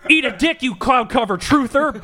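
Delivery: very high, loud mock insult; angry shout. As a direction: snarl an insult in a fake brawl. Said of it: "mock weather fight".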